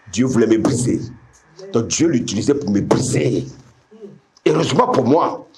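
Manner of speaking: normal talking voice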